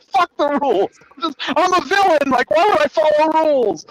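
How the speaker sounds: high, exasperated mock outburst; defiant villain. Villain answering those who say it's not allowed, defiant. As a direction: imitate a villain throwing out the rulebook, voice way up.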